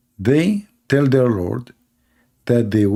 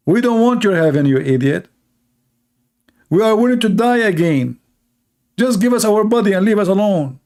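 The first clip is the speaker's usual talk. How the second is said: higher, pleading and fed up; mock desperate plea to be left alone; exasperated martyrs; 6 semitones higher than usual. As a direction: souls in heaven begging God, exasperated. Martyrs begging Allah for bodies back.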